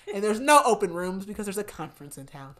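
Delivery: usual speaking voice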